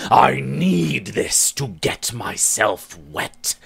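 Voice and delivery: usual speaking voice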